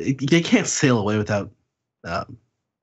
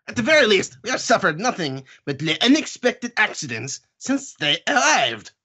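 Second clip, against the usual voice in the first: high, hammed-up accented Count; grating theatrical voice, swooping high; grating character voice; 4 semitones higher than usual. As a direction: declaim it as an indignant, grating nobleman. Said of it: "That voice can get kinda grating".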